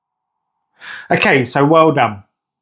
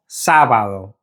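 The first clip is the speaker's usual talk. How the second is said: lower-pitched "Sábado", leaning on "Sá"; stress on first syllable; 5 semitones lower than usual. Shown as a demonstration. Teacher modelling for learners, "Sábado" stressed on "Sá". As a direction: press on "Sá" and let "bado" drop. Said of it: "we need to stress that first syllable".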